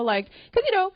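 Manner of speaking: usual speaking voice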